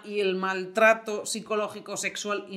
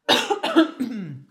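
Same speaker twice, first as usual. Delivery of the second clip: choking cough; 3 semitones higher, 4 dB louder than usual. Real, not acted. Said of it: "Perdón, que me ahogo".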